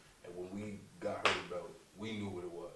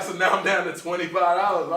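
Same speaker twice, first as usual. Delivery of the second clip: usual speaking voice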